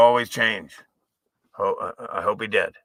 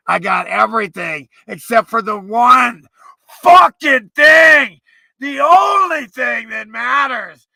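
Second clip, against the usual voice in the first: higher, mocking, building to a furious shout; mocking sellout rant; 6 semitones higher, 5 dB louder than usual. Mocking a sellout, building into a screaming rant. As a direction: start sneering, end screaming.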